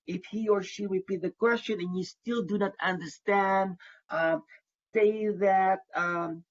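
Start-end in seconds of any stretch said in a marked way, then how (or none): none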